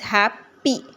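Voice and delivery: हैप्पी broken into हैप, पी; split syllables. Shown as a demonstration. Teacher reading the next example word happy, split syllables. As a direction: say हैप, pause, पी. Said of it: "syllables को अलग-अलग करने के लिए".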